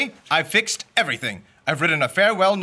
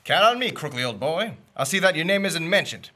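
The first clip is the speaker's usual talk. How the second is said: normal talking voice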